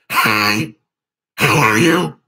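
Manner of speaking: words honked out like a goose; goose call voice. Shown as a demonstration. Streamer showing chat his goose-call voice, honking the words. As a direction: talk in your goose-call honk. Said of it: "That's the goose call".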